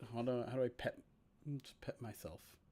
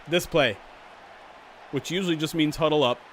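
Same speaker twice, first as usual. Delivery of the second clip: louder, brisk sportscaster call of the kickoff return; play-by-play commentary; 5 dB louder than usual. Acted. Streamer calling the Madden sim to viewers, announcer style.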